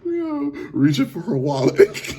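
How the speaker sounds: high, drawn-out words through laughter; laughing. Real, not acted. Reactor repeating the comedian's punchline, laughing. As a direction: repeat the punchline while cracking up. Still cracking up at joke.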